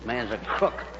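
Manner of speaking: low, soft grumbling aside; muttered grumble. Acted. Higgins grumbles to himself about Hooper, low and soft. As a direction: grumble it under your breath.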